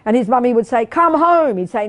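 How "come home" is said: higher, mildly raised calling voice; mother calling out. Retold or imitated. Mother calling little son down from the mountain, mock calling out. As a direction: call a wandering child back, lightly acted.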